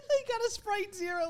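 high laughing scoff; mock him through laughter; laughing mockery. Real, not acted.

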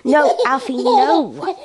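loud sharp telling off; firm no. Real, not acted. Scolding raspberries.

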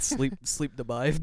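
loud, slow, laughing echo; mock the slurred word through laughter; laughing imitation. Teasing co-host's sleep deprived, slow laughing mimicry.

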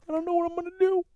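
very high, soft, squeaky fake wail; hammed-up whimpering despair; mock crying. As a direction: squeal it like a pretend crybaby. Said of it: "I might cry today".

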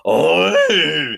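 loud high rutting call; stag bellow. Retold or imitated. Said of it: "my stag impression".

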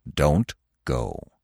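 low, soft, grave warning; old stag. A narrator's reading. Old stag warning Bambi, low and grave. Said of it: "said the old stag".

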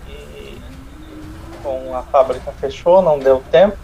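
ordinary talk

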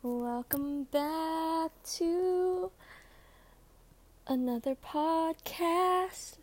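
slow, drawn-out host intro; podcast host voice. Pretend. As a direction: stretch it like a show opener. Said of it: "Just kidding".

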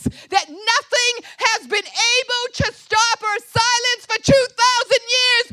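high, loud preaching at full pitch; full-force preaching. Real, not acted. Preacher declares a cry nothing could silence, full-force shout. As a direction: thunder it out without letting up.

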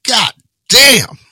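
loud, high drill sergeant bark; drill sergeant shout. Retold or imitated. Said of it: "He's like".

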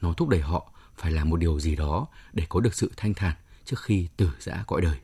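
ordinary delivery

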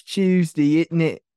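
exaggerated British "Tuesday, isn't it"; hammed-up British pronunciation; mock British accent. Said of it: "what American people think British people sound".

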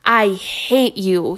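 mild mock shout, slow; a boy shouting at his teacher, slow and understated; shouted in her face. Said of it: "he shouted in her face".

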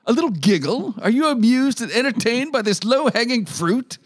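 high, hammed-up indignant scolding; over-the-top outrage. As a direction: read it like a pompous outraged critic. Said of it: "It's so over the top".

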